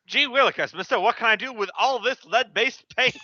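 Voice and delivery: put-on high, eager gee-whiz kid voice; chirpy innocent kid asking mister; wholesome 1950s kid. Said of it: "some half orc comes by".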